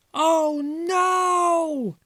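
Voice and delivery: high squeaky cry of alarm; mice crying out. Acted. Little mice seeing Father stranded, high squeaky alarm. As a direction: squeal it in panic like a tiny mouse. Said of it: "cried the seven little mice".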